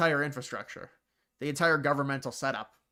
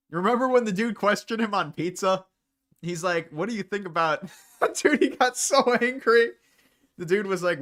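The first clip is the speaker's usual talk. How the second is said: excited laughing retell; 6 semitones higher, 8 dB louder than usual. Real, not acted. Laughing while retelling a clip.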